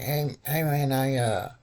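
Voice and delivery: prank caller in a put-on old man voice; disguised voice calling the cousin about the couch.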